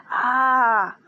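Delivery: a sudden, loud, angry "Ah!"; angry shout. Retold or imitated. Furious boss ends his firing speech with a loud shout. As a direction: punctuate the threat with a yell.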